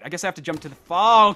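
ordinary delivery

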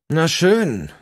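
smiling, grudging concession; with a smile. A narrator's reading. Olaf yielding to the crowd, forced smile. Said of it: "sagte er schließlich mit einem Lächeln".

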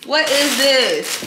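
slow, high and loud puzzled question; slow, high baffled question. Real, not acted. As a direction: draw it out in disbelief. Baffled by item.

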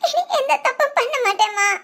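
high, teary vow to mother; tearful promise. Acted. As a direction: promise through tears. (அழுகையுடன் உறுதிமொழி)

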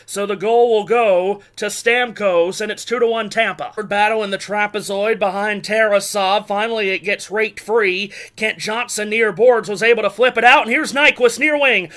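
ordinary delivery

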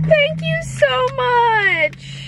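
high, stretched-out squeal of thanks; grateful squeal. Real, not acted. Vlogger thanks a viewer for a gift, grateful squeal. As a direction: draw the thanks out high and delighted.

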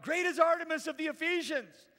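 loud, high rallying chant; enraged cry. A narrator's reading. Angry crowd shouting for their goddess, enraged cry. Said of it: "were enraged and were crying out".

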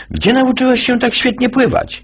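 high put-on lifeguard voice. Lifeguard questioning the boy, high voice. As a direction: pitch way up for the lifeguard.

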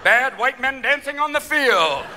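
high, loud, stretched-out announcement; football referee. Acted. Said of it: "football referees who have that".

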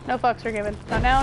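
usual speaking voice

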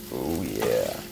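usual speaking voice

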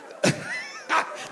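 high-pitched helpless laugh; real high laugh. Real, not acted. Preacher unable to go on telling it, high helpless laugh. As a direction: break into squeaky laughter. Laughs again mid-story.

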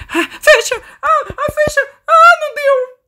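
very high, loud panicked cries; panicked shrieking. Real, not acted. Player caught closing the closet, panicked. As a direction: shriek as the door fails to shut.

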